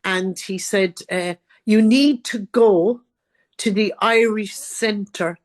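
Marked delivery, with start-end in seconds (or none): 1.7-5.4 slow, deliberate directions with a firm start; real slow. Retold or imitated. Scottish man directing a lost Irish newcomer, real slow. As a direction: imitate someone spelling it out slowly for a newcomer. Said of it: "had to talk real slow".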